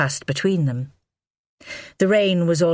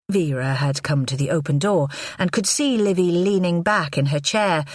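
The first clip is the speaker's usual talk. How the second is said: ordinary delivery, nothing marked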